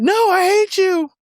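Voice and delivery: put-on high, drawn-out kid outburst; angry child. Child rejecting dad's offer to hang out, high angry protest. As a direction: hurl it up high like an upset child.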